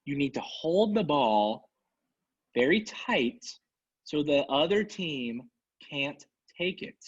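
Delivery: put-on drawn out, as if to a child; slow and condescending. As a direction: spell it out slowly, mock patient. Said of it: "I'm gonna say it very slow so you'll understand".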